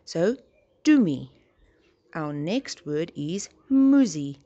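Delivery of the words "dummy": dummy said doo-mee, a bit louder. Shown as a demonstration. Teacher models dummy for the children, oo vowel. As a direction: say dummy with an oo, stressed. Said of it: "the A sound becomes, becomes a oo".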